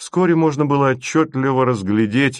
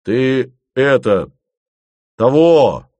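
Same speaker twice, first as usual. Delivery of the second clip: much louder angry outburst; 3 semitones higher, 6 dB louder, slower than usual. A narrator's reading. Fomin, angry, cuts Asen short. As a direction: lose your temper at him. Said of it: "тоже осерчал Фомин".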